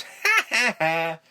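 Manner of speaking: high mock "ha, ha, ha"; mock laugh. Retelling the cartoon hero raising his mallet, high mock laugh. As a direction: punch out a staccato cartoon laugh. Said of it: "holds it up and just goes".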